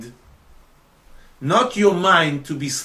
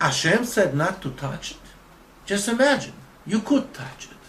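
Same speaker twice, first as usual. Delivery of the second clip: higher-pitched, lightly acted incredulous tempter; tempter's coaxing; 7 semitones higher, faster than usual. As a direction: coax her with mock disbelief. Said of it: "what he says to her".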